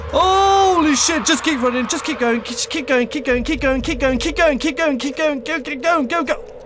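panicked yelling while fleeing; being chased. Real, not acted. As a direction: scream it, running for your life. Fleeing a monster in game.

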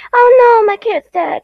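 hammed-up loud wail of panic; mock parental panic. Parents voiced mourning dead kid.

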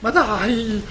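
loud exasperated outburst; frustrated shout. Real, not acted. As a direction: throw it out in disbelief. Frustrated at losing kills.

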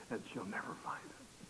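hushed, whispered retold secret; conspiratorial whisper. Jim plotting to hide cigarettes from his mother, whispering. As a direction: drop to a sly whisper. Friend's secret hiding plan.

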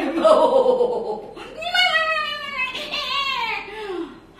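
long, high, loud wail; drawn-out yowl of a frantic animal; animal screech. Acted. Cat and dog chased in story.

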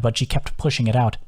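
usual speaking voice

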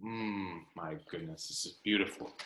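soft, low, marvelling murmur; hushed awe. Real, not acted. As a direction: breathe it out in quiet wonder at the picture. Marvelling at a book page.

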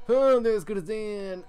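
high, loud triumphant cry; gleeful boast. Acted. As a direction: crow about the catch. Cop boasts about arrest.

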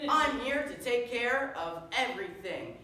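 high, loud heroic boast; superhero proclamation. Acted. Superhero promising the crowd his help, bombastic. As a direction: declare it proudly to the crowd. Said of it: "your friendly neighborhood superhero".

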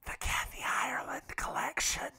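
hushed, voiceless aside; stage whisper. Pretend. Friend slipping in a brand name as a joke, whispered. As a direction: whisper it softly as a playful reveal.

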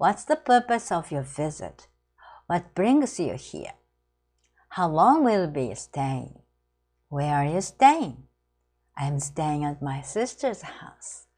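slow, careful English phrases read for learners; slow model reading. Shown as a demonstration. Teacher reading phrases slowly to students, slow and clear.

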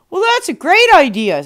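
loud, high, eager agreement; enthusiastic husband. Acted. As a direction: burst out in delight. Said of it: "her husband said".

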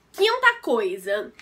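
normal talking voice

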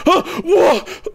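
high, loud mock freakout; mock spaz attack. Mocking a teammate spazzing alone in his room, high squeals. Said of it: "having a fucking spaz attack".

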